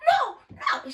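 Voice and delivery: high imitated protest; mock scream. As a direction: squeal it out. Girl resisting the bouncer.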